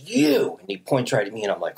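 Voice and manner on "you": high, accusing mock shout; single out someone with a pitched-up yell; imitated angry outburst; mock screaming. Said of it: "he just starts fucking screaming at us".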